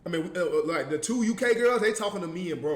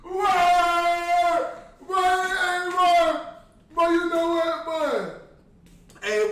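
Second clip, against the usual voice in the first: hyped slang voice; 5 semitones higher, slower than usual. Acted. Exaggerated slang voice.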